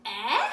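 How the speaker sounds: loud questioning Eh; the short e sound Eh, called out loud. Shown as a demonstration. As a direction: say Eh as an asking shout. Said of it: "the letter E can say".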